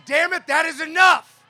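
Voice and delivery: loud high rallying cry; rallying shout. Real, not acted. Union leader leading the crowd, shouting the refrain. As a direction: throw it to the crowd. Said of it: "and say".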